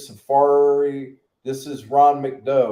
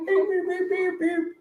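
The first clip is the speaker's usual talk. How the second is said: beeping noise. Pretend. Imitates a beeping sound.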